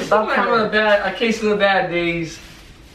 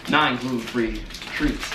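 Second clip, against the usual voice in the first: lower, softer legal fine print; ad disclaimer read; 8 semitones lower, 6 dB quieter than usual. Pretend. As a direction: rattle off the disclaimer quietly.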